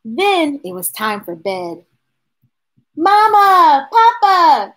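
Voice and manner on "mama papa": high, louder call from another room. Acted. Charlie calling his parents from his bedroom. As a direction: call both parents from bed. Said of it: "Charlie called from his room".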